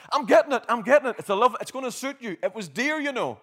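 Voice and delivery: loud, eager call upstairs-to-downstairs; dad shouting down the stairs, loud and excited. Retold or imitated. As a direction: call it out loud from another room. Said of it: "he shouts down the stairs".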